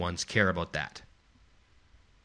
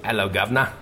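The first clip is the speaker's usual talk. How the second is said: mock London accent. Shown as a demonstration. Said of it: "you give me your best London accent".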